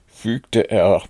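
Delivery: ordinary delivery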